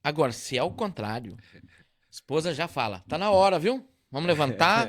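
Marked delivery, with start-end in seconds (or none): 3.1-4.9 louder, higher wake-up call; wife waking husband early, loud. Retold or imitated. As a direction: rouse him out of bed.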